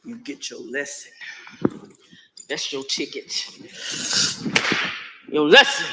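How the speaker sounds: a low, stern mother's scolding that swells into loud bursts; stern mother warning a child; stern scolding parent. Acted. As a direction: lay down the law before the whipping. Parent scolding before a whipping.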